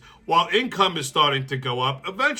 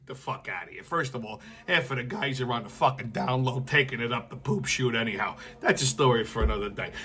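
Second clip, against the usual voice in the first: much softer, low throwaway; quiet aside to the audience; muttered aside; 6 semitones lower, 6 dB quieter than usual. Real, not acted. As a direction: mutter it under your breath.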